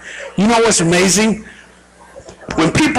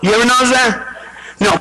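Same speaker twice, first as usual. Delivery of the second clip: ordinary delivery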